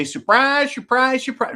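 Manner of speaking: Gomer Pyle. Acted. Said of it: "As Gomer Pyle would say".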